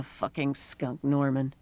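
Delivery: soft, low echo in agreement; quiet agreement. A narrator's reading. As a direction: calmly echo her words back. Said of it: "Gert was nodding".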